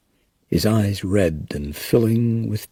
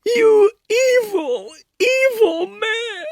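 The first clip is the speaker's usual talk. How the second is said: sobbing curse; 4 dB louder, slower than usual. Acted. Grieving mother curses Brody.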